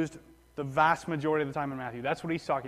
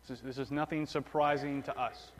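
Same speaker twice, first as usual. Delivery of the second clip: usual speaking voice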